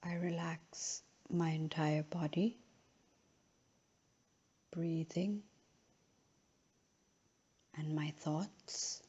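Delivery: unhurried, measured phrases, each left to settle; guided relaxation. Real, not acted. Yoga teacher guiding a final relaxation in Shavasana, slow and calm.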